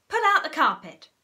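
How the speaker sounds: loud, high command; shouted order. A narrator's reading. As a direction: order the animals to pull. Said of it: "shouted Mugglewump".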